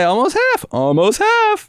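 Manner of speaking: excited, very high-pitched repeat; delighted. Real, not acted. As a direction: cheer the small win in a high voice. Celebrates a low alpha score.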